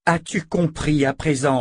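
anger pressing against restraint; shaking with anger. Acted. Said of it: "Callan tenta de contenir sa colère".

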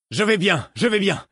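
high, loud half-deaf shout; yelling without realising it. Acted. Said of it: "je me rendre compte que je hurlais".

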